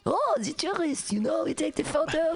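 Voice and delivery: put-on French accent, pitched high and gushing; French tourist. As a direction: play a delighted French tourist. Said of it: "are like".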